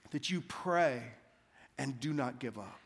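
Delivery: hushed, earnest preaching. Acted. Slow hushed refrain.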